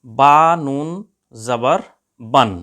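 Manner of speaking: "বা নুন জবর বান" read loud and drawn out, the last word stretched; slow it right down and chant each syllable; slow recitation demo. Shown as a demonstration. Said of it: "উচ্চারণ হবে". (ধীরে টেনে টেনে উচ্চারণ)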